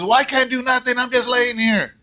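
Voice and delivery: high, loud whining complaint; whiny husband. Retold or imitated. Husband in a hospital bed complaining to his wife, high whine. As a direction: whine it like a sulky patient. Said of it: "He goes".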